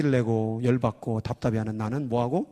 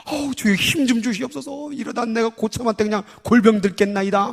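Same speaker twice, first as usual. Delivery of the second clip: desperate soldier prayer; 7 semitones higher, 3 dB louder than usual. Retold or imitated. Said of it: "힘들어 죽겠어가지고".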